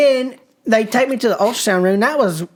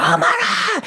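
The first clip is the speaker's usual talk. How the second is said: high-pitched retold cry of alarm; alarmed granny; 12 semitones higher, slower than usual. Granny runs into the bathroom to find him, alarmed. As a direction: imitate a panicked granny, voice pitched way up.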